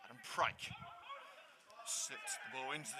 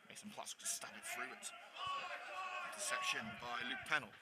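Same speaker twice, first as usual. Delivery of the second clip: play-by-play; 5 dB quieter than usual. Acted. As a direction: follow the ball calmly, pass by pass. Live play-by-play of the match.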